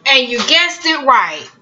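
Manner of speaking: ordinary talk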